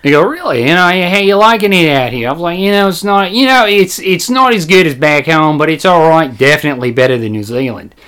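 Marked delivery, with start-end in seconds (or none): 0.2-2.3 higher, curious Australian customer; Australian accent. Retold or imitated. Customer asks worker about living here, higher Australian accent. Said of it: "Remember the Australian guy". 2.6-7.9 put-on Australian weighing things up; wry little joke in an Australian accent. Worker jokes with customer about home, fake Australian accent. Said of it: "haven't done an Australian accent".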